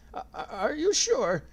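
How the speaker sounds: put-on high, slow, trembling old man; quavering voice. A narrator's reading. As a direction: stammer in fear. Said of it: "in a quavering voice".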